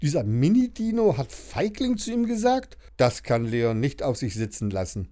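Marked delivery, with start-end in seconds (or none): 0.0-2.8 higher, offended disbelief; outraged kid; indignant. Acted. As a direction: Leon can't believe he was called a coward. Leon offended by insult.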